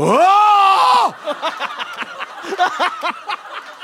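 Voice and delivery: high howling laugh; loud burst of laughter. Real, not acted. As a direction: crack up completely. Host cracks up at story.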